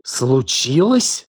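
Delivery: slow, incredulous question; outraged echo. A narrator's reading. Елена cuts off his excuse, outraged. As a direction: stretch the word in disbelief. She throws his word back.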